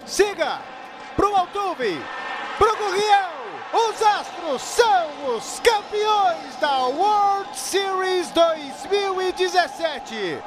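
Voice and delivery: championship call. Real, not acted. Championship-winning final out call.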